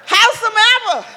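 ordinary talk, nothing marked

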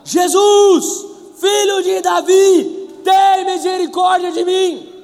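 crying out high and loud, slowly, pleading; desperate cry. A narrator's reading. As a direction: call to Jesus from the roadside at full voice. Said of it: "ele começou a gritar".

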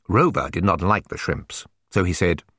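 usual speaking voice

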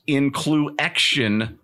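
"Incluection" said slowly as in-clu-EK-shun; extra X sounded. Shown as a demonstration. Host mocking an event name, "Incluection" with the X sounded. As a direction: spell out the X sound inside "Incluection". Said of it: "They put the extra X in inclusion".

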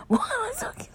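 high, soft giggly squeak; laughing. Real, not acted. Podcaster cracking up at her own odd voice, laughing. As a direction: say it through helpless giggles, high and quiet.

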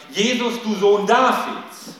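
loud, high, slow call of the title; the real cry. Retold or imitated. Preacher showing the beggar's actual confession, loud cry. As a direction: proclaim him as Son of David.

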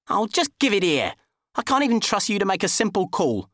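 high, snappy fussy man; exasperated squeaky grumble; impatient high man voice. Acted. Dickie's voice, impatient.

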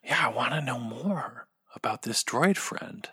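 hushed, breathy whisper. Real, not acted. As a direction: lean in and whisper it. Hushed remark.

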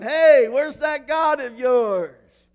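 loud, drawn-out taunting call; trash talking. Acted. Elijah taunting the prophets of Baal, loud and mocking. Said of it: "Elijah starts trash talking a little bit".

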